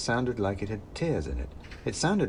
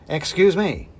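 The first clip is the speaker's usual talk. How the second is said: loud polite call; 8 semitones higher, 8 dB louder than usual. Acted. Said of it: "I said".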